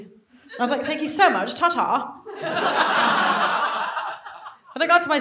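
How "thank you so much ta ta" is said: higher, louder posh English thank-you; chirpy mock English accent; put-on posh English accent. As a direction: gush like a fake English lady. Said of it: "So I just kept the English accent".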